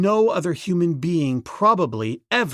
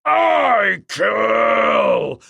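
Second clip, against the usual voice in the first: loud shrieks rising higher; shrieking Golat; 5 semitones higher, 5 dB louder, much slower than usual. Acted. The king ape refusing peace, rising shrieks. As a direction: shriek the threat over and over. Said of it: "shrieked Golat".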